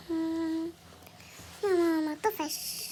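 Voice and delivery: slow, drawn-out bored complaint; bored whine. Acted. Child nagging mum out of boredom, bored whine. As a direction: drag it out to nag mum.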